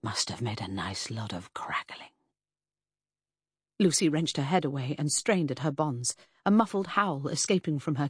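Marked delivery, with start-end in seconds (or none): 0.0-2.1 low, cruel whisper; murmured in her ear. A narrator's reading. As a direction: breathe it menacingly into her ear. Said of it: "brushed it against her ear and murmured".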